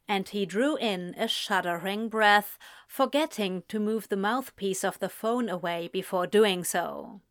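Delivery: ordinary delivery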